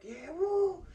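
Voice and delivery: high, loud mock woo; fake cheer. Inmates cheering on hearing it's prison, a forced whoop. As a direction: cheer along with the crowd, hamming it up. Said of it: "so I'm like".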